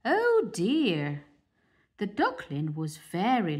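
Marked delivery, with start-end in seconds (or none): 0.0-1.3 splutter. Acted. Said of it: "The old duck splurted".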